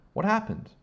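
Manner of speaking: high, loud shocked question; startled, high-pitched gasp of a question. A narrator's reading. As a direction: ask it in shock, voice leaping up. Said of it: "she gasped".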